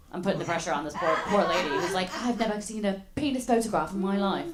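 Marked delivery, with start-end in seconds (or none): 2.2-4.5 badly faked South African accent; hammed-up South African accent; terrible South African accent. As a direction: imitate a South African lady, clumsily. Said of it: "That was a terrible South African accent".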